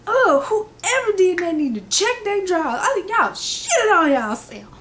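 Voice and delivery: high, loud comic scolding; mock outraged yelling. Imitating coach scolding the class, outraged high yell. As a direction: holler it in exaggerated disgust.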